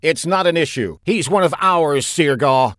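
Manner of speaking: forceful comeback over the speakers; Gon. A narrator's reading. Admiral defending his man to the enemy, booming. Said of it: "Gon's booming voice came resonating".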